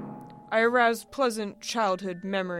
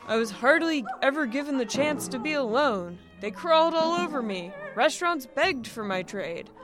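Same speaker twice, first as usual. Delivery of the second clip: higher, louder, vehement protest; exasperated outburst; 5 semitones higher, 6 dB louder than usual. A narrator's reading. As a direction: protest as if pounding the desk. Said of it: "beat the desk with all four".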